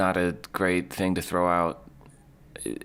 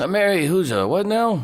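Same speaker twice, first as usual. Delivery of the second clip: confused; 11 semitones higher, 6 dB louder than usual. Real, not acted. Confused reaction to clip.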